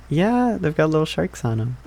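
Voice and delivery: usual speaking voice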